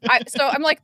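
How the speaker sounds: usual speaking voice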